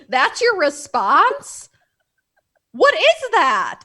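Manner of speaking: slow, stunned outburst at an absurd response; incredulous. Retold or imitated. Mock outrage at film characters' reaction.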